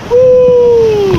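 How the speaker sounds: loud joyful woo; excited whoop. Real, not acted. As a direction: whoop it out with glee. Cheering on rapids.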